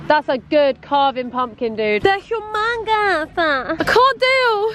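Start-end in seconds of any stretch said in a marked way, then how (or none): 2.0-4.7 slow, high overwhelmed gush; excited. Real, not acted. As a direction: stretch it out, overwhelmed. Overwhelmed by pumpkins.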